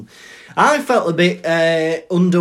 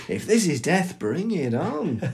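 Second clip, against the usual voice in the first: high, hammed-up bravado; gleeful relish; 5 semitones higher, 4 dB louder than usual. Mock dying Harry loving it, hammed-up delight. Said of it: "he's loving it".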